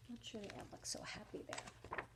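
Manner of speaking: barely voiced, leaning in; whisper. Real, not acted. Reader whispering to listening kids, softly unvoiced.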